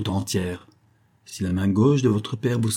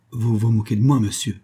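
ordinary delivery

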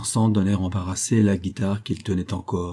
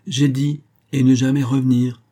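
louder, insistent repeat; firm repeat; 4 semitones higher, 5 dB louder than usual. Acted. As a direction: drive the words home a second time. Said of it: "répéta Ford".

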